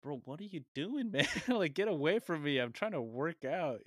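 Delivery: high, loud mock exasperation; exasperated protest. Interrupted gym-goer to a pestering older man, acting out exasperation. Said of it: "And I'm like".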